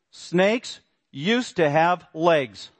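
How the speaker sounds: very slow, word by word quotation; slow quote. Shown as a demonstration. Preacher reading a National Geographic quote, very slow. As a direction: spell out each word of the quote.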